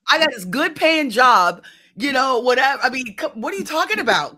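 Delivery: high, loud, slowed disbelief; stunned it's even suggested; incredulous disbelief. Retold or imitated.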